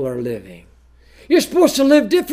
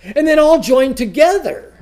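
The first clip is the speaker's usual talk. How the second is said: lightly mocking, raised and louder; mock church voice; 7 semitones higher, 6 dB louder than usual. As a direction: parody the easygoing churchgoer. Mocking churches that shun doctrine.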